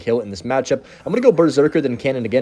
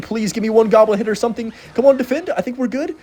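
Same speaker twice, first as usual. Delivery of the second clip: tense pleading; 9 semitones higher than usual. Real, not acted. Final seconds of tight match.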